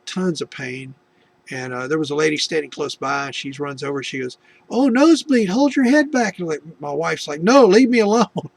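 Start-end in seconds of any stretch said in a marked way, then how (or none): 4.7-6.4 high, loud, fussing bystander voice; hurried helpful stranger. Retold or imitated. Bystander lady rushing to help. 7.4-8.5 high, loud brush-off; mock protest. Injured wife refusing help.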